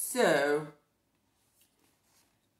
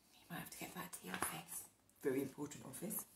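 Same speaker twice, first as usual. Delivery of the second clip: ordinary delivery, nothing marked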